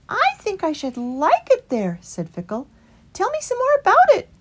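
high, loud doubtful rabbit; young rabbit. A narrator's reading. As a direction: ask it high and wary. Fickle eager.

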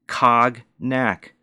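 "Cognac" said as "KAHG-nak", hard g; spell-it-out "Cognac" with a hard g; mock misreading. Mocking the manager's botched wine words, sounded out. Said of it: "That's how she pronounced it".

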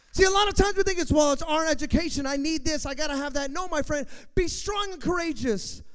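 rally the congregation with a roar; shouting pulpit appeal; full-force preaching. Acted. Continued preaching.

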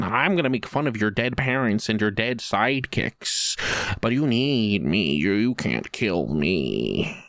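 a half-hearted mock Joker, dragging the words; bad Heath Ledger Joker. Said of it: "worst impression of Heath Ledger's Joker".

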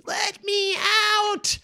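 very high, drawn-out squeaky plea; tiny trapped voice. Pretend. Felix pounding inside the gem, tiny faraway cry. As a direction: cry out like a miniature man shut inside a gem. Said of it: "very faintly".

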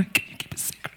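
voiceless, very soft whisper; hushed secret-sharing whisper; whisper to neighbor. Shown as a demonstration. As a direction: whisper it so only the consonants carry. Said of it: "Ask your neighbor".

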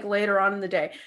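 ordinary talk, nothing marked

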